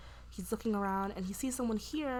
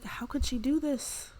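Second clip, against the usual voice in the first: slow, high, louder retold disbelief; shocked; 6 semitones higher, 5 dB louder than usual. Steven questions what she did, acted shock. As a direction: act out his drawn-out incredulity. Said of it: "like, complete shock".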